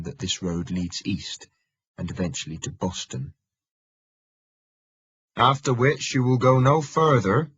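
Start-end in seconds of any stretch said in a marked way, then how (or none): 5.4-7.5 put-on higher and louder, in a familiar local country accent; a gruff rural voice with a familiar local accent. A narrator's reading. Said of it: "he says in a familiar accent".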